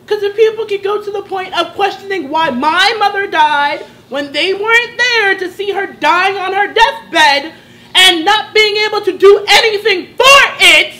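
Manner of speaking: hurt anger rising into near shouting; real indignation. Real, not acted. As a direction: build to a pained, emphatic peak on the last words. Indignant about questions on mother's death.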